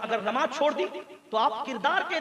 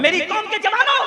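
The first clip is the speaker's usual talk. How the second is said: high, loud rallying call; ringing preacher's appeal; 9 semitones higher, 10 dB louder than usual. Real, not acted. Calls out to the young men.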